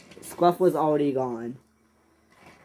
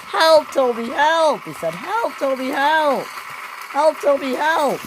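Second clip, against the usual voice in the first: calling for help; 11 semitones higher than usual. Acted. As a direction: keep calling out to your friend, high and urgent. Scruff stuck, calling to Toby.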